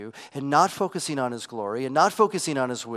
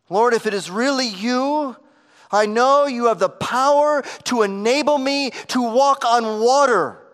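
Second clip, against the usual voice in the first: raised, louder voice of faith, ringing out; bold declaration; 9 semitones higher, 10 dB louder than usual. Acted. Preacher voicing Peter's bold faith, louder.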